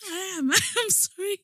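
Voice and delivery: high, giggly apology; crying laughing. Real, not acted. Insisting to the friend she is sorry, laughing through it. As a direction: apologise while helplessly laughing. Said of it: "Now, now you're crying".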